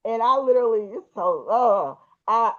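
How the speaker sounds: usual speaking voice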